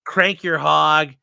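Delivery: ordinary delivery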